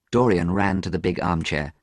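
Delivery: plain reading voice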